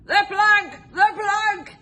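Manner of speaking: high, loud chanted yell. A narrator's reading. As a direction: yell it over and over. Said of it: "yelled Ben Buckle and Percy Patch".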